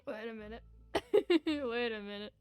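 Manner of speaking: ordinary talk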